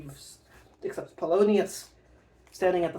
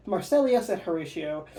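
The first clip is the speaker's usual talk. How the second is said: ordinary talk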